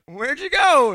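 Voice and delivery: imitated kid calling after someone; calling after friend. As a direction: call it out like a bewildered child. Child calls after fleeing friend.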